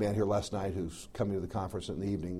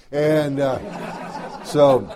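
laughing; 4 semitones higher, 10 dB louder, much slower than usual. Real, not acted.

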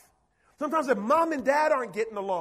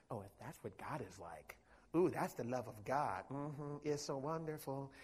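mocking whisper of the devil, much softer and lower; 10 semitones lower, 15 dB quieter than usual. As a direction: hiss sly lies into their ear like the devil. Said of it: "the devil whisper in your ear".

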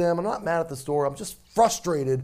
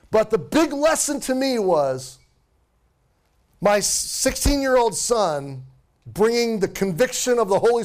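raised, emphatic preacher's delivery; impassioned preaching; 10 semitones higher, 6 dB louder than usual. Real, not acted. Pastor telling the congregation what his son taught him, impassioned preaching. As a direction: drive the lesson home, pitched high and stretched for emphasis. Preacher drives home the story's lesson.

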